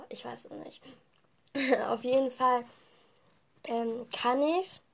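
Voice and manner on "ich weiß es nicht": unvoiced muttered aside; whispering. Real, not acted. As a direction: whisper it to yourself. Unsure aside to self.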